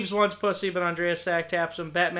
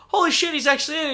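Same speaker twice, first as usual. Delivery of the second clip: high, fast excited disbelief; surprised; 9 semitones higher, 5 dB louder, much faster than usual. Retold or imitated. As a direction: blurt it out in amazement. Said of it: "I go".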